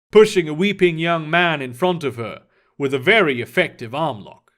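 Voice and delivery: usual speaking voice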